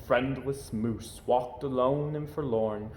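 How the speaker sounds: ordinary delivery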